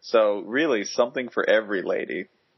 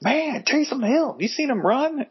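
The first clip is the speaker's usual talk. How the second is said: excited fan voice. Retold or imitated. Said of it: "it's like".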